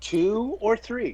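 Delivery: high, slow pondering rabbi. Pretend. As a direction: muse over it like a wise rabbi. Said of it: "'Cause rabbis always ask questions".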